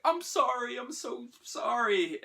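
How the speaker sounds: high-pitched tearful apology, imitated; hammed-up weepy wail; mock bawling. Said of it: "she's bawling and she's saying".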